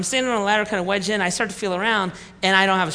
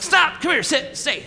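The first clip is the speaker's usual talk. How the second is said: mock dog command; 7 semitones higher than usual. Calling another kid like dog.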